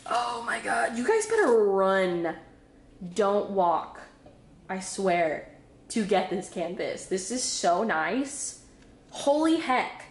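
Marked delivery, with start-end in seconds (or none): none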